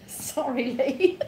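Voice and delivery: high, laughing apology. Real, not acted. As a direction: apologise through real laughter. Cracks up over the misread postcode.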